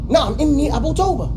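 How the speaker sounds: firm stress on inni; insisting. Shown as a demonstration. Teacher showing an emphatic reply to doubt. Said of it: "You see the difference now?".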